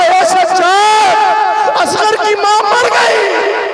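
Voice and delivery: very slow, high, drawn-out wailing shout; crying out. Acted. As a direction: Zainab wails the news of a death. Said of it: "Zainab ने रो के कहा". (ज़ैनब का सज्जाद से रोते हुए चीखना)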